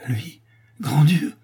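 slow stricken exclamation; horrified cry. A narrator's reading. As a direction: recoil at the very name. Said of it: "s'écrie Toinette".

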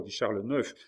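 usual speaking voice

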